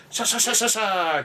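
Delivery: high, rapid "sha" swishes; imitated swishing work noise. Man mimicking old hotel ladies making beds, brisk swishing noises. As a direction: imitate someone zipping through chores.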